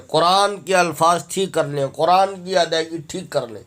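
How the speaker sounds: ordinary talk, nothing marked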